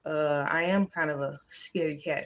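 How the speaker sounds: low, soft self-doubting voice; lightly acted, subdued self-talk; lying inner thoughts. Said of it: "them stupid lying thoughts be saying".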